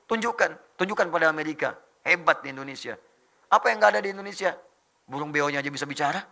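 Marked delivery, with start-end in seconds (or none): none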